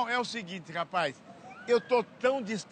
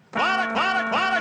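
playful call; 9 semitones higher, 4 dB louder than usual. Acted.

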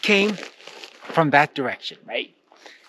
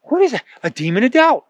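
much higher, shocked gasping cry; startled shock; 10 semitones higher, 3 dB louder than usual. Acted. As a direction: jump out of your skin. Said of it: "was surprised".

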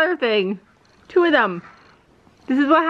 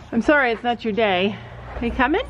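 ordinary talk

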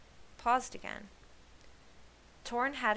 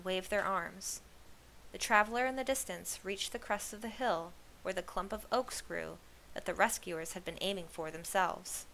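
plain reading voice

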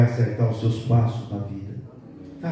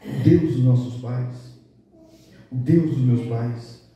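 slow emphasis; slower than usual. Acted. Preacher slows on key phrase.

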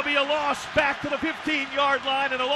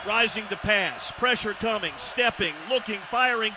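ordinary delivery

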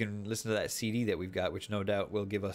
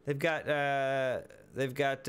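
ordinary delivery

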